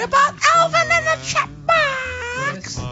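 very high, slow chipmunk voice. Acted. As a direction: squeak it out like a chipmunk. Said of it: "Is that, is that your chipmunk voice?".

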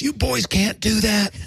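high, drawn-out mock telling-off; mock scolding. Jack Lemmon confronts the boys doing donuts, mock scolding.

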